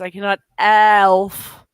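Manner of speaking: slow, congested "Elf"; blocked nose drags "Elf"; stuffy nose. Real, not acted. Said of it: "I am drippy of the nose".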